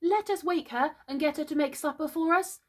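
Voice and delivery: put-on high small-boy voice; high-pitched childlike suggestion; Michael. A narrator's reading. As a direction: propose it like an eager child. Small brother proposes a plan.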